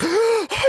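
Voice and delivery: high, sudden gasp; imitated gasp. Man just released from being frozen, mock gasp.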